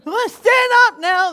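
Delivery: mock bossy preacher. Mimicking the church leader, loud command. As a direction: bark it like a stiff pastor. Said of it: "they talk like this".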